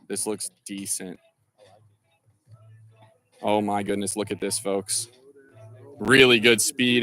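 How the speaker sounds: hushed golf commentary; golf commentator. Pretend. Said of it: "getting my Sports Center on here".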